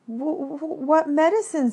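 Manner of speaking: high, stuttering, frightened question; stammering fright. Acted. Piglet scared of Kanga's medicine. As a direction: stammer it in panic.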